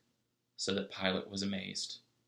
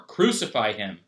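loud crowd shout; 8 dB louder than usual. A narrator's reading. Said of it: "they shouted all the more".

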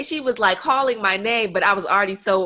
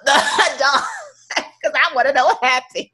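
laughing through the complaint; laughing in disbelief; 4 semitones higher, slower than usual. Real, not acted. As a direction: giggle while venting.

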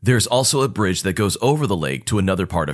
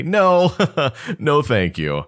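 nervous laugh; 5 semitones higher, slower than usual. Retold or imitated. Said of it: "I laugh nervously".

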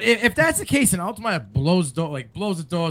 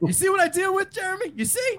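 high, fast teasing complaint; playfully exasperated, laughing; mock exasperation; 12 semitones higher than usual. Real, not acted.

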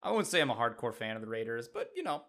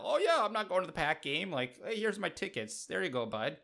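higher, louder put-on Midwestern accent, casual and folksy; 8 semitones higher, 3 dB louder than usual. Green Bay local shrugging off his Pack game tickets, in a Midwestern accent. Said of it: "I don't know what accent that was".

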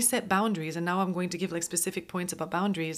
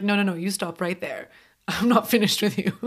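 louder, firm mock telling-off; mock scolding; 7 dB louder than usual. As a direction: playfully put them in their place. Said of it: "I'm like".

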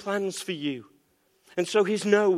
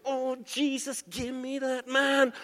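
soft, higher, dreamy mock plea; mock longing prayer; 6 semitones higher, 6 dB quieter, slower than usual. Churchgoer eyeing a muscle man prays with mock longing. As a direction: sigh out a hushed wishful prayer. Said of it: "you're thinking".

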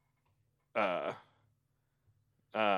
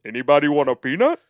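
louder Fezzik voice, lifting on the question; Fezzik impression; 3 semitones higher, 6 dB louder than usual. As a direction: do a playful Fezzik character voice. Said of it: "That was Fezzik. That was pretty good".

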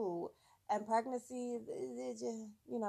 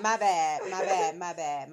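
2 louder, laughing through "my bad"; 3 apologise while you can't stop laughing; 1 laughing apology; 4 semitones lower, 11 dB louder than usual. Real, not acted.

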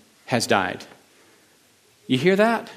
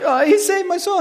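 much higher, eager reply; excited answer; 3 dB louder than usual. Retold or imitated. Himself shouting back to the preacher, high and excited. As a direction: answer back all fired up. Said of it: "And I'm like".

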